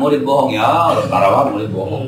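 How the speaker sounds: usual speaking voice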